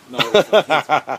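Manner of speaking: loud, slow, sarcastic drawl. Real, not acted. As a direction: draw it out mockingly. Sarcastic reply about rain.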